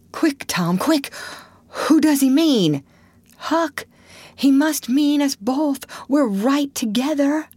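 put-on high, frightened boyish voice; panicked urgency. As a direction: demand an answer in panic. Terrified boys ask whom dog means.

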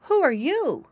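high, wondering piglet; exclaimed question. A narrator's reading. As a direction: ask a stranger as a startled pig. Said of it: "Squinty finally exclaimed".

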